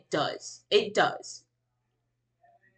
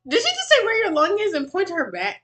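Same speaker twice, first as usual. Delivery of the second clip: incredulous; 8 semitones higher, faster than usual. Real, not acted. Streamer reacting to a video about lungs, incredulous. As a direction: question it in sheer disbelief.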